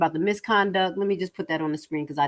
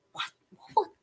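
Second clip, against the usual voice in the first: ordinary delivery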